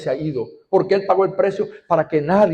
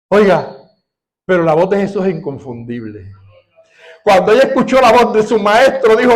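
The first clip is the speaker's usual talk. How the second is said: loud, high, fervent sermon delivery; preaching at full force; 7 semitones higher, 8 dB louder than usual. Acted. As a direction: proclaim it to the whole congregation.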